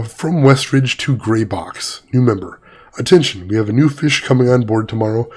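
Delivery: usual speaking voice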